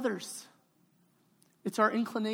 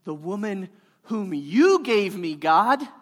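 a touch louder, accusing Adam pleading his case; Adam blaming God; 6 dB louder than usual. Acted. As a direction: point the finger at God, a little louder. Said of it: "What was Adam's response?".

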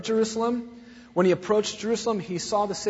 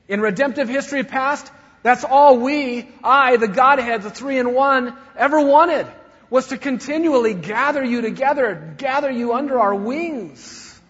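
louder, higher fervent plea; passionate longing; 6 semitones higher, 9 dB louder than usual. Acted. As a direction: pour out the longing. Voicing the Godhead's longing.

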